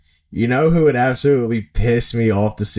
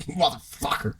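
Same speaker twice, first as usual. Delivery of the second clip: muttered curse; 5 semitones higher, 9 dB quieter than usual. Retold or imitated. Batter facing Greinke's slow Eephus.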